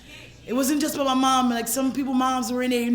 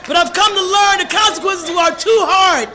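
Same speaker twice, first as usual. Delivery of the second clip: loud, high preaching force; impassioned testimony; 5 semitones higher, 7 dB louder than usual. Real, not acted. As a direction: proclaim it to the whole room. Impassioned testimony to recovery meeting.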